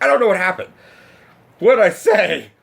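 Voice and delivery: high, loud panicked disbelief; overwhelmed. Retold or imitated. Dazed fan asks his friend what happened, overwhelmed. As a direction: ask in a high, frantic voice.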